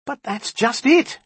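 very high, slow emphatic outburst; exasperated cry. A narrator's reading. Magician pounces on the djinni's words, crying out. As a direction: seize on the point, pitched right up. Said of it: "he cried".

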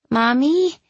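put-on high, questioning child's voice; confused, fearful. A narrator's reading. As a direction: ask it high and uncertain, a touch scared. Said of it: "her voice full of confusion and fear".

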